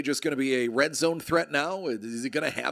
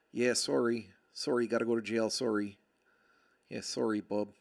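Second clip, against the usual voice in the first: put-on Canadian accent; 3 semitones lower, 3 dB quieter than usual. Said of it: "As only a Canadian could".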